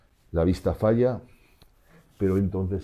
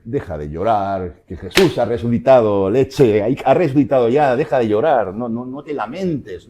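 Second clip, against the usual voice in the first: much louder, pushy mock rebuke; mock scolding; 4 semitones higher, 11 dB louder than usual. Preacher imitating what the angels did not say, loud mock scolding. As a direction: bark it out like a bossy comforter. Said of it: "No le dicen".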